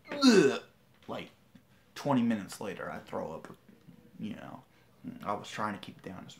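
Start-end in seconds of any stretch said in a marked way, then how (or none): 0.1-0.6 loud high ugh of disgust; disgusted groan. Retold or imitated. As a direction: belt out a sick groan. Retching after trying to stay.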